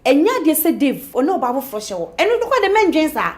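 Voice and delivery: loud, high urging of a friend; urgent urging. Acted. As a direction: push her to act now. Friend urging action loudly.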